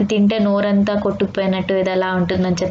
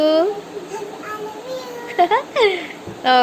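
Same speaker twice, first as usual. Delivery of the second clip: laughing; 12 semitones higher than usual. Real, not acted. Laughing after kid says love you.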